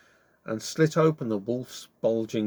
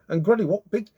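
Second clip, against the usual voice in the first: squeaked; 9 semitones higher than usual. Acted. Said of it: "she squeaked".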